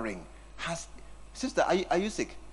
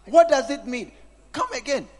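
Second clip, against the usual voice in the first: louder and higher, pressing the question; loud demanding repeat; 7 semitones higher, 8 dB louder than usual. Real, not acted. Preacher pressing the congregation about phones, louder. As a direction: hammer the question at them. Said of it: "repeated question, second louder".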